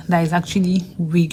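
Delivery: normal talking voice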